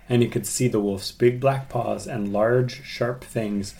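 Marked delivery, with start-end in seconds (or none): none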